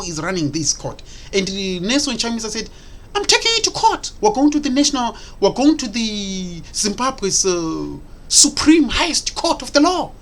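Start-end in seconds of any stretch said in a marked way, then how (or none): 3.1-10.1 mocking quote. Mimicking Chamisa's announcement.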